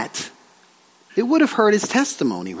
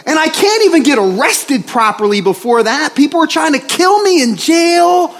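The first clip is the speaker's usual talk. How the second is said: mock angry yelling; 7 semitones higher than usual. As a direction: pretend to lose it, shouting at God. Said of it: "and he starts yelling".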